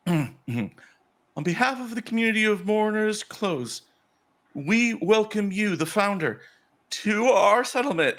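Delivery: high, slow ceremonial address; measured, high-pitched formal welcome; welcoming priestess. Acted. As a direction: make a grand speech to an honored guest. Priestess gives formal welcome speech.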